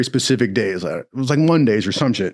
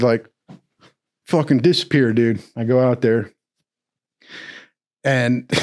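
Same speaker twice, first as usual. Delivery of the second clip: ordinary delivery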